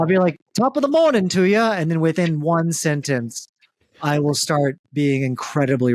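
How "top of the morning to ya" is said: high, jaunty mock Irish accent. Improviser starting an accent scene, mock Irish accent. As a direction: pitch it up into a stagey Irish greeting. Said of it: "or an Irish accent".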